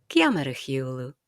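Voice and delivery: Gaelic question "Ceamara hiúlú" with the pitch coming down at the end; falling question. Shown as a demonstration. Teacher contrasting the Gaelic question with English, falling tune. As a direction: let the tune fall away on "Ceamara hiúlú". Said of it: "In Gaelic we come down".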